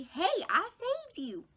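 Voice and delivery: high, slow, mocking cheer; self-styled rescuer. Friend boasting that she saved her, mocking high voice. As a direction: mimic a proud fake hero. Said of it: "so she could say".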